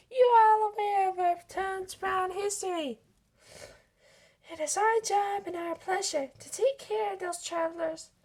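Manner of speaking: rally the town proudly; Sally rallying the crowd; Sally's speech. Acted.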